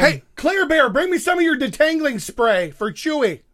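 high, loud pet-name call; yelling across the room. Pretend. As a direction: shout a request into the next room. Calling to his wife for spray.

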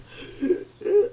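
loud, high mock-panic gasp; panicked gasping. Re-enacting the last paycheck panic, comic gasping. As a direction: gasp as if freaking out. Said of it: "I freaked out".

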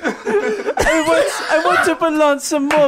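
Oliver Twist begging. Acted. Fired player begging the boss to let him belong, high and pleading. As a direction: beg like a starving Victorian orphan. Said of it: "millennial Oliver Twist".

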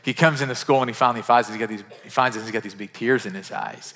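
ordinary delivery

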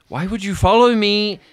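high, loud, frustrated telling-off; exasperated scolding. Acted. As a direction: scold him for following. Sky scolding the one who followed.

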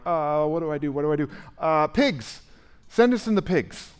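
panic, then blurt out the pigs; frantic panic. Acted. Demons looking around frantically, pleading high and loud. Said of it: "they're so panicked".

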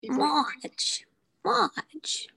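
Marge call. Retold or imitated. Friends trying Marge lines, calling her name. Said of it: "now is Marge Simpson".

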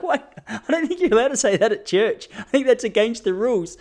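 high, laughing, scandalised; playfully scandalised; amused shock. Retold or imitated. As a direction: tease her in mock outrage.

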